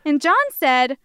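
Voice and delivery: ordinary talk, nothing marked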